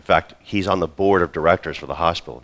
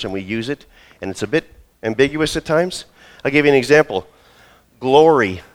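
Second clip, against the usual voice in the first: normal talking voice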